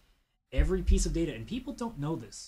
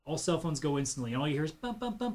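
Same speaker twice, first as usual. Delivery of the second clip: usual speaking voice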